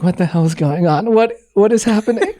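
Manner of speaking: rising baffled questions; mild mock bewilderment; confused. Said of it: "I'm like".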